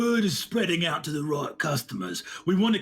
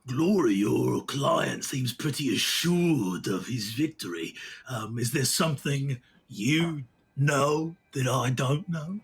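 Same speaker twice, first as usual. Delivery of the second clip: much lower sly voice; low, slow suspicious promoter; 5 semitones lower than usual. Acted. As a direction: probe what the manager knows. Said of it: "he says".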